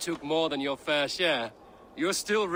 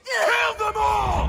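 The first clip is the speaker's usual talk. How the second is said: slow, roaring war cry, much higher and louder; battle shout; 7 dB louder, slower than usual. Acted. Warrior roaring at his warband to kill. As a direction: bellow the order to slaughter.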